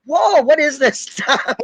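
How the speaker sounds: high, drawn-out mock astonishment; acted-out bewilderment. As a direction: balk slowly at something utterly strange. Acting out brother-in-law's bewilderment.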